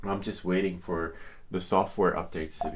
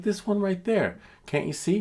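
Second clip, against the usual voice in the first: high, louder exasperated pointing; mock exasperation; 12 semitones higher, 4 dB louder than usual. Demanding the clerk see the sandwich, high exasperated. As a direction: squeak it, frustrated. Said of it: "I was like".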